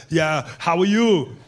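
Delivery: slow, drawn-out celebrity impression; offhand greeting in an imitated Austrian accent; Arnold Schwarzenegger. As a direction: answer slowly as the accented action star. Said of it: "He was just like".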